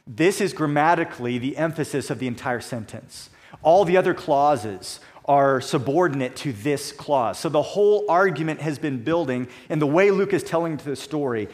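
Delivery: ordinary talk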